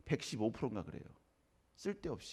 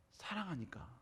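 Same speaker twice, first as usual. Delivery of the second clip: much softer, gently lowered; tender answer; 10 dB quieter, slower than usual. Acted. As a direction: let the answer land quietly and tenderly. Soft answer after outburst. (부드럽고 조용하게)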